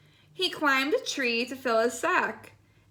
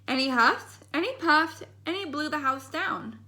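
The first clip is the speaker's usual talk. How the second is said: ordinary delivery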